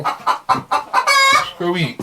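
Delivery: very high, slow pet voice; high drawn-out sing-song to a hen; sing-song call to a hen. Real, not acted. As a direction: draw it out way up high, coaxing the hen. Calling the hen to eat.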